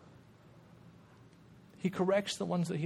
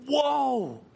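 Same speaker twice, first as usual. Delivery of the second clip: ordinary talk, nothing marked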